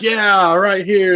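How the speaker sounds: high, drawn-out mock holler; acted calling out. Friend retelling the hero waving up the convoy, high calling voice. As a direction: call it as if flagging people down.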